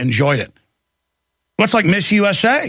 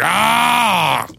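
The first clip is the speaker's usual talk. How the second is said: startled yelp; 4 semitones higher than usual. Retold or imitated. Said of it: "he was just like".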